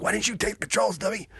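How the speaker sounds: high mock scold at the copilot; high put-on scolding voice. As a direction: chew him out in a squeaky put-on voice. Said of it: "I was like".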